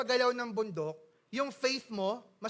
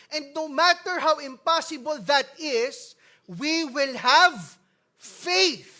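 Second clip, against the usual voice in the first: building to a loud, high climax; slow, then cry out "faith"; preaching; 4 semitones higher, 8 dB louder, slower than usual. Acted. Climax of sermon. (nangangaral, papalakas hanggang sumigaw)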